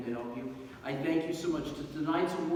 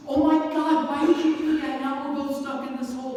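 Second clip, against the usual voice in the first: high-pitched, loud mock dismay; acted exclamation of disbelief, starting loudest; appalled outburst; 10 semitones higher, 8 dB louder than usual. Stuck deputy to the friend who jumped in, appalled outburst.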